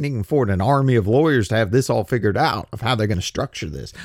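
normal talking voice

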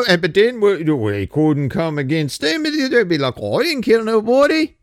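high put-on European accent, lightly hammed; a bad continental accent again; horrible foreign accent. Mocking the people answering lawmakers in a bad accent. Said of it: "The horrible accent".

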